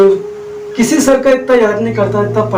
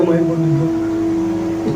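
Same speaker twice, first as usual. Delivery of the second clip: softer and slow, as if stunned; slow quiet repeat; 3 semitones lower, 6 dB quieter, much slower than usual. Retold or imitated. Stunned as the mic cuts out on stage, soft and slow. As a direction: let it sink in, almost to yourself.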